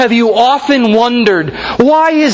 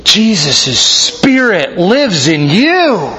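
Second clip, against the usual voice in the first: slow, hushed wonder; awed; 3 semitones lower, much slower than usual. Retold or imitated. Father telling his children about the Spirit, slow and awed. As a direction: say it slowly, full of awe. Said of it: "with awe in my voice I told my children".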